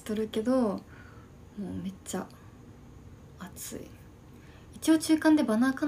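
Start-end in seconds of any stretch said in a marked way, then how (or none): 1.6-3.8 hushed whisper, fading out. Real, not acted. Whispering a complaint about the heat to chat. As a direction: breathe it out quietly. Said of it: "今日小声で喋る".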